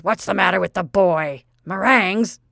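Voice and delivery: loud, gruff complaint; cranky old grandfather complaining about the boy; grumpy old man. Acted. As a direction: grumble loudly like a cranky colonel.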